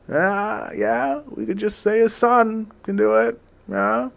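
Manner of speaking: high, squeaky eager agreement; eager lords. Retold or imitated. Lords eagerly agree to crown the son, high squeaky voice. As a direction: pipe up in a high chipper voice.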